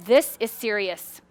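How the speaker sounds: low, slow and soft, laughing a little; understated alarm, far too calm; mock low-key seriousness; slow, deadpan. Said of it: "you're like".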